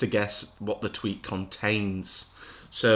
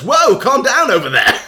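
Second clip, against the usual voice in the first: laughing; 11 semitones higher, 12 dB louder than usual. Retold or imitated. Reading reply tweet, laughing.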